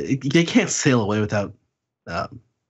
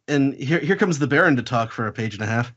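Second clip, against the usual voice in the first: ordinary talk, nothing marked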